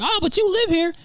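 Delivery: high whiny mock girlfriend voice. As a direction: pitch it up to mimic her whining. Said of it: "She was like".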